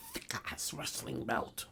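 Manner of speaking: slow hushed whisper. Acted. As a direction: whisper it like a dread secret. Dramatic aside about belt.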